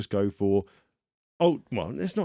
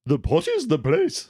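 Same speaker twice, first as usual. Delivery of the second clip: lightly regal, slow queen; a hint of a stately queen; 4 semitones higher, 4 dB louder than usual. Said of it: "said the Queen".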